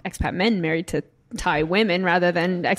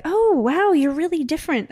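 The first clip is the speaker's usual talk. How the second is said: mock amazed acquaintance; 8 semitones higher than usual. Acquaintance back home marveling at her, mock amazement. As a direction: play the old acquaintance, amazed at how you changed.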